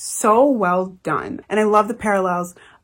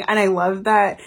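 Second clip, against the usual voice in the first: usual speaking voice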